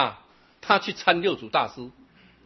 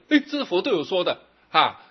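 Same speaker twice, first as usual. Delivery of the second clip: ordinary delivery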